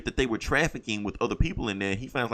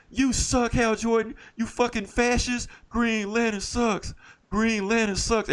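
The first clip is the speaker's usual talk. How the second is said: jeering crowd; 10 semitones higher, slower than usual. Retold or imitated. Planet's crowd jeers at hero.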